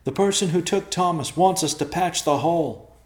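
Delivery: loud, high shouted explanation; high-pitched long-distance shout; yelling. Acted. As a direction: holler the news up to friends. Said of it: "Evercloud yelled back".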